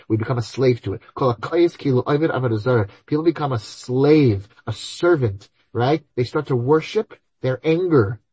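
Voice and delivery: ordinary talk, nothing marked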